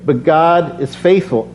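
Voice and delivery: ordinary talk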